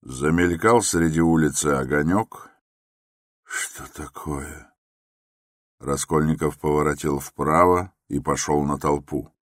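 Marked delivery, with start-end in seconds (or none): 3.4-4.7 very soft, slow question; puzzled thought. A narrator's reading. Raskolnikov sees a distant crowd, quietly puzzled. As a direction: wonder under the breath.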